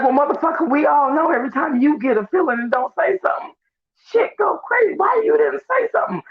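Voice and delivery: high, rising mock scolding that ends in a shout; exasperated crowd scolding. Everybody in the room turns on him, high exasperated shouting. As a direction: play the whole room fed up with him, pitch climbing to a shout. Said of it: "everybody in the room be like".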